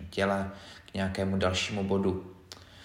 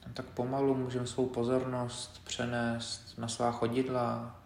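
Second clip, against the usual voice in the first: softer, measured body-scan guidance with long pauses; quiet, unhurried meditation leader; guided meditation; 9 dB quieter than usual. Acted. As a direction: guide them gently through the body, leaving space between phrases.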